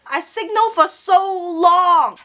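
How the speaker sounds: put-on high, slow wail in a Chinese accent; Chinese-accented complaint. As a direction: stretch out the grievance in a mock Chinese accent. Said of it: "very heavy Chinese accent".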